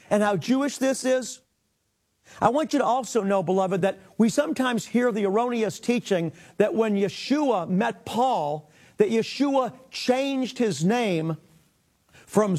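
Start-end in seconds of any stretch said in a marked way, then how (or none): none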